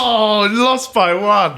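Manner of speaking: drawn-out hammed-up cry of defeat; slow, loud, mock-anguished wail. Loses game by one point.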